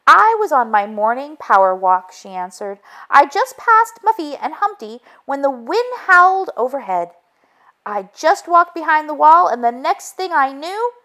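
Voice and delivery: high, anxious hen voice rushing through it; Chicken Little, flustered. A narrator's reading. Hen telling the detective what she saw, high and flustered.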